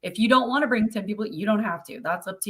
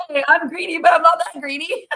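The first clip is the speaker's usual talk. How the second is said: high, loud mock defence through amusement; laughing-along mock protest, high and loud; joking protest; 7 semitones higher, 6 dB louder than usual. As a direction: play up a jokey comeback, pitched way up. Joking answer to friends' teasing.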